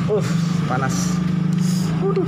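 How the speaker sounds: sudden high yelp at burning heat; startled yelp. Real, not acted. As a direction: jump as if you touched something hot. Touches hot roller housing.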